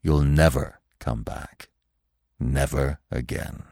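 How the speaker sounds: low, slow, soft sorrow; slow, deep voice heavy with sadness; sad. A narrator's reading. Old stag warning Bambi of no return, slow and sad. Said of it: "said the old stag sadly".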